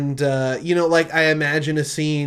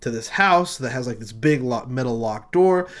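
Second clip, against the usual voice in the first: ordinary talk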